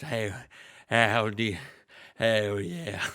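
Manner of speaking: low, drawn-out wrestler greeting; Stone Cold. Acted. Game host voices a wrestler coming out, low and drawling. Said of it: "out comes Stone Cold".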